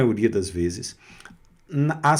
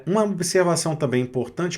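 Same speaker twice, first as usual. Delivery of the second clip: normal talking voice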